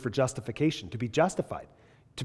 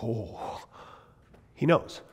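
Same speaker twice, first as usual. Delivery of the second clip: awed hush; 3 semitones lower, 6 dB quieter, much slower than usual. Pretend. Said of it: "wouldn't you just go".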